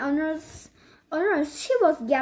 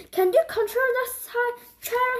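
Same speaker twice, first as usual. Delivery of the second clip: hissing; 8 semitones higher than usual. A narrator's reading. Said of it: "hissed Pipsy Pearl".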